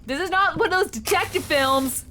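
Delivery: high-pitched indignant rant; exasperated protest. Real, not acted. Player wants away from the nosy stranger, exasperated. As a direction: insist on it, voice climbing. Player rants at game character.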